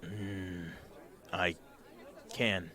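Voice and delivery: low, soft grunt of a reluctant big guy; gruff companion. Acted. Big companion answering the player, low and soft. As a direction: mumble it like a big quiet warrior. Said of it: "he goes".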